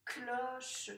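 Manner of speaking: "Cloche" in two beats, clo-che, final e sounded; split into syllables. Shown as a demonstration. Teacher tapping syllables for a pupil, "Cloche" split clo-che. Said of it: "la première ou la seconde".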